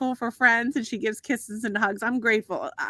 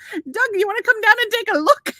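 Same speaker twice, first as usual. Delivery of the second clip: put-on high, louder Irish accent for the doctor; 10 semitones higher, 5 dB louder than usual. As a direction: call him over in a cheery Irish brogue. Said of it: "he goes".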